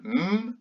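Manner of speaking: "m" held as a long hum; son m isolé. Shown as a demonstration. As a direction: sound out "m" alone, not the letter name. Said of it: "et quatre sons". (son « m » tenu, bouche fermée)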